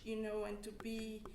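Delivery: usual speaking voice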